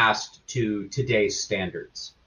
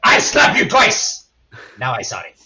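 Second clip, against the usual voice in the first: loud mock German-accented outburst, then dropping lower; imitated bad German accent; 3 semitones lower, 4 dB louder than usual. Mocking the movie Grandpa who beats his granddaughter, bad German accent. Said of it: "terrible accent".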